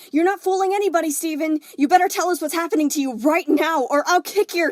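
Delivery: high, loud, fast angry threat; angry tirade. Acted. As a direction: threaten him, furious. Amethyst angrily threatening.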